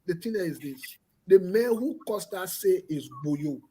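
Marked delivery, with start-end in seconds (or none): 1.3-3.6 slow, deliberate, word by word; slow, careful delivery for the listeners; said slowly. Shown as a demonstration. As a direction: spell it out slowly so nobody misses it. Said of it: "let me say it slowly".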